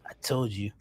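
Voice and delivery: hushed murmur sinking to a whisper; Gary. Retold or imitated. Podcaster imitates someone looping a clip, hushed and secretive. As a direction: drop low and soft, then whisper. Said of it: "he would loop it and like".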